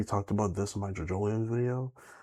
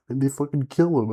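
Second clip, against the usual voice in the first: louder and higher, indignant; raised, higher-pitched complaint; outraged; 5 semitones higher, 8 dB louder than usual. Real, not acted. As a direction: vent the outrage. Outrage at favourite dying.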